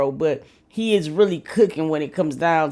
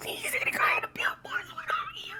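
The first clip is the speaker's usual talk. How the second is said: breathless laughing whisper; 10 dB quieter than usual. Real, not acted. Repeating a friend's joke, laughing whisper. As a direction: wheeze the words through laughter.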